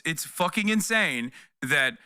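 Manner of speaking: ordinary talk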